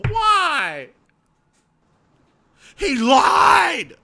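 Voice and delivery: high, loud mocking kid's yell; mock whiny yell. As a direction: shout it back like a petulant child. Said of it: "they go".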